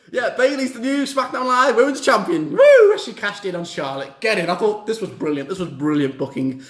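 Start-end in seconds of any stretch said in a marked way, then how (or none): 2.5-3.0 very loud, high woo; loud woo cheer. Real, not acted. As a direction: scream the cheer. Cheering Bayley's win.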